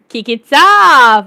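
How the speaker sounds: loud, slow, drawn-out catchphrase; hyped call-out. Real, not acted. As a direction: belt it out, stretching the last word.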